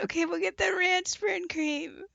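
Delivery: high loud panicked yelling; frantic yelling. Pretend. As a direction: shout it like a frantic medic. Mock frantic nurse yelling.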